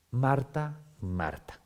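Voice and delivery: primer "Marta" subiendo, segundo "Marta" cayendo y suave; "Marta, Marta" dicho con cariño y pasión; énfasis en Marta, Marta. Shown as a demonstration. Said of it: "hago este acento porque Marta". (llamar "Marta, Marta" con vehemencia tierna)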